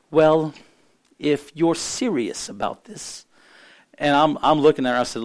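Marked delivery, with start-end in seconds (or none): 0.1-3.3 imitating a woman's near-British South African accent, drawn out slowly. South African woman answers the weeping minister, in imitated South African accent. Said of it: "almost sound British".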